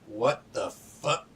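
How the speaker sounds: slow, stunned, word by word; drawn-out disbelief. Acted. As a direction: pause on each word, dumbfounded. Captain pauses at sudden harpoons.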